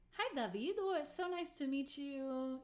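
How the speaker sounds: high, sweetly gushing hello; gushing greeting. Retold or imitated. Musician greets a fan who came up, high gushing politeness.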